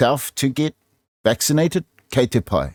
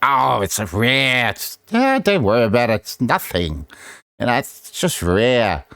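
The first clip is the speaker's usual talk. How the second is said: mocking media. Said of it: "now mainstream media".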